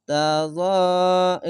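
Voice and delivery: তাযা drawn out on its last long vowel; one-alif stretch. Shown as a demonstration. Teacher showing learners the one-alif stretch, তাযা with a lengthened final vowel. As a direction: slowly stretch the end of তাযা. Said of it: "এক আলিফ টান হবে".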